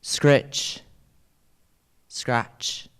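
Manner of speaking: breathy scraping whisper; whispered razor scratch. Acted. Poem's razor sound words.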